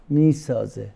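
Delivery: normal talking voice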